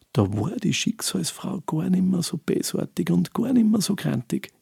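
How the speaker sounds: ordinary delivery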